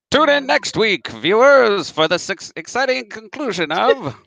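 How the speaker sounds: put-on old-timey radio announcer, high and loud; hammy serial cliffhanger announcer; 1950s narrator. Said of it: "old-timey radio".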